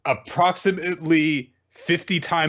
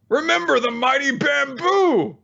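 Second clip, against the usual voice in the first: intense captain; 12 semitones higher than usual. Retold or imitated. Captain's bamboo speech.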